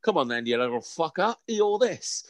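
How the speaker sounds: lightly acted fighting taunt; mock challenge. Man squaring up to a donkey, mock challenge. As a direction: goad it into a pretend scrap, pitch jumping up at the end. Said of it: "not gonna be like".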